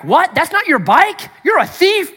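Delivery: high, loud, outraged caricature of a scolding God; preacher mocking a nitpicking God; mock accusing God. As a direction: play God as a petty accuser catching you out. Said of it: "like God does not know".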